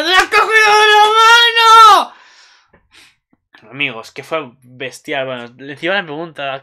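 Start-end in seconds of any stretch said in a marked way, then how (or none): 0.0-2.1 very high, loud fangirl shriek; excited fan scream. Retold or imitated. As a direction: shout it in shrill, thrilled disbelief. Excited fan re-enacting the scene.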